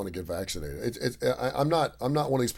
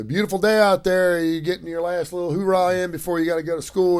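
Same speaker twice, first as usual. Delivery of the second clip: chatty worker; 6 semitones higher than usual. Retold or imitated. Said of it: "And he's like".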